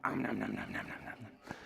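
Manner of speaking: low playful om nom chomping; greedy munching sounds; munching noises. Pretend. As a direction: gobble it up like a hungry bear. Eating more food.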